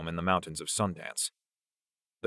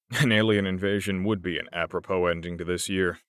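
ordinary delivery, nothing marked

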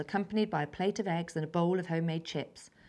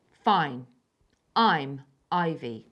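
louder, slow and grudging; curt answer; 3 semitones lower, 6 dB louder, much slower than usual. A narrator's reading. Ivy answering Lydia grudgingly, curt. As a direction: give her name reluctantly. Wild girl answers curtly.

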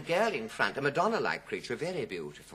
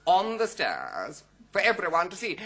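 bus-queue woman; 5 semitones higher, 4 dB louder than usual. Retold or imitated. One woman to another in a bus queue, gossipy. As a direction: play a gossip holding forth. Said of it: "this woman in the bus queue was saying".

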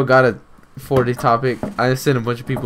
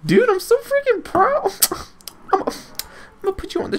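high squeal; faster than usual. Pretend. Squealing mock pride.

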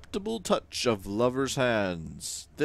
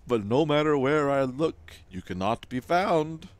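grand, louder timeless Weaver; 5 semitones higher, 7 dB louder than usual. Acted. Game Weaver laments to the player, grand and louder. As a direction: speak as an ancient weaver of time.

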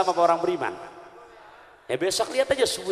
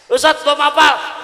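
high, loud, fast protest; protesting imam; 10 semitones higher, 10 dB louder than usual. Retold or imitated. Imam protests to the ustadz he cannot speak Arabic, high flustered shout.

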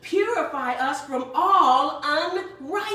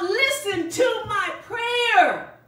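slow, raised cry of "Listen to my prayer"; shouting prayer; 6 semitones higher, 3 dB louder, much slower than usual. Acted. Nehemiah crying out to God, slow raised shout. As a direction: call out slowly to God. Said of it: "he shouts out".

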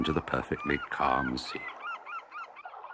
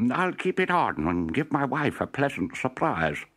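higher, pleased professor voice; delighted professor; 8 semitones higher than usual. Acted. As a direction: relish the surprise you're planning. Professor delights in his plan.

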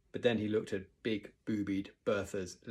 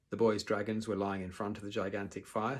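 ordinary delivery, nothing marked

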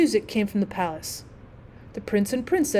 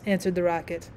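ordinary delivery

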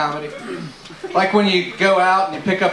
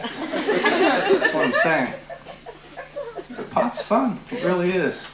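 slow stone monotone; 7 semitones higher, 4 dB quieter, slower than usual. Pretend. Drawn-out stoner catchphrase.

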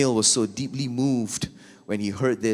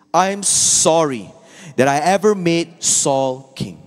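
higher, louder, slow and grave; voice of God; 7 semitones higher, 8 dB louder, slower than usual. Acted. God telling Samuel of his regret, slow and solemn. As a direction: let God's regret ring out slowly.

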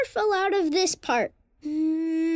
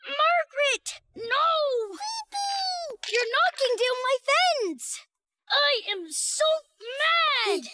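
put-on high, upset childlike yell; angry; 7 semitones higher than usual. Brother whose fence is being knocked down, angry. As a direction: cry out in outraged protest. Sister knocks down his building.

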